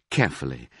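ordinary delivery, nothing marked

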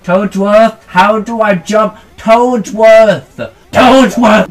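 loud calls for Toadsworth; mock calling out. Player hollering at Toadsworth for jump help, loud calls. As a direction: yell the name across the room, half joking.

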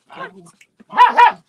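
high, then loud call to the dog. Real, not acted. Owner calling her dog to come, high loud call. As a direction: snap the dog to attention.

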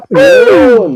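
high, loud burst of triumph; loud delighted exclamation; excited exclaim. Real, not acted. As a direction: cheer it out. Exclaiming after a loud crack.

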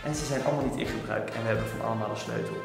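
hushed secret whisper, fast; whispering while hiding. Real, not acted. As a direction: whisper so she won't hear. Secretly planning hide and seek. (fluisterend, stiekem)

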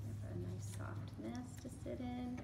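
soft tender whisper. Real, not acted. Librarian whispering to children about the hen's nest. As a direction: hush it like tucking her in.

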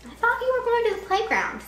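a lightly voiced dad, lifted high; father's voice. A narrator's reading. Dad asks his son, a lightly voiced father. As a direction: gently voice the father. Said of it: "said Father".

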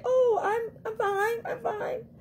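high, teary insisting on fine; hammed-up brave face, near tears; high mock sobbing fine; tearful fake fine.

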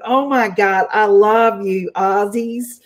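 badly faked American fan gushing; terrible American accent. Aussie host playing an American fan of Aussies, terrible American accent. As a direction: put on a hammy American accent. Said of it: "that's a terrible American accent".

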